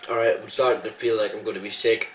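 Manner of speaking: ordinary talk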